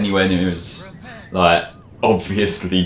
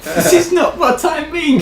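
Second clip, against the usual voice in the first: high, slow, laughing scold; 5 dB louder than usual. Retold or imitated. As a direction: scold through laughter, pitched up. Playing Maria scolding Shadow.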